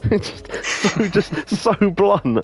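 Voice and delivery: squeezed out through laughter, very slow; can barely speak for laughing. Real, not acted. Amused retelling the podcast story, laughing through words.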